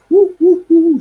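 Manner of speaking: very high, loud monkey hoots; jumping ape whoops; chimp hoots. Retold or imitated. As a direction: hoot like an excited chimp. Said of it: "jumping up and down".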